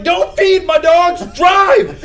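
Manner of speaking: high, loud shout imitating an irate dog owner; full-voiced, high-pitched yelling, acted out; retold scream. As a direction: scream it across the yard. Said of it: "the lady's still screaming".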